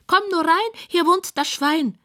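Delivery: pig's high-pitched squeal. A narrator's reading. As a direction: squeal a welcome in a high piggy voice. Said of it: "quiekt es von der Couch".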